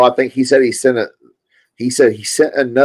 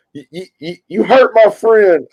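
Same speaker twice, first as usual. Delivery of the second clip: imitated serious upset; 10 semitones higher, 3 dB louder than usual. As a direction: mimic a wounded friend in a raised voice. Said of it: "he starts getting serious with it. And he's like".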